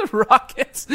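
words breaking up with laughter; laughing. Real, not acted. Co-host joking about fickle Houston fans, laughing. Cracks up while talking.

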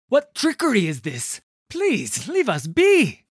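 loud, high frightened plea; alarmed shout. A narrator's reading. Tarnold confronts the ghosts.

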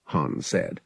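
ordinary delivery, nothing marked

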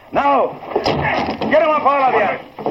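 bust in and shout them down; commanding shout. Acted. Sergeant bursting in on the crooks, shouting commands.

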